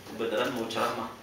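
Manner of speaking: normal talking voice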